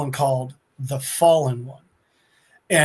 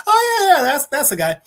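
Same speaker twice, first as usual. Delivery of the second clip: squeaky high excitement; 10 semitones higher, 5 dB louder than usual. Retold or imitated. King Thanos recalls Adam Warlock.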